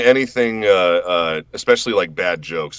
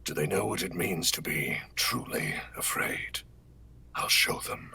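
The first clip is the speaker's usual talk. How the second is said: soft unvoiced whispered threat; Omen voice; 3 semitones lower, 10 dB quieter than usual. Voice actor reciting a game line on stream, quiet whispered menace. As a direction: whisper it like a hidden predator.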